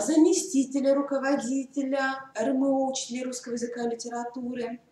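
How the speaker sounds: ordinary delivery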